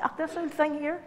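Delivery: high, self-deprecating brush-off; hammed-up modest brush-off; mock dismissive reply. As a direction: act out waving off a compliment. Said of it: "Somebody will say".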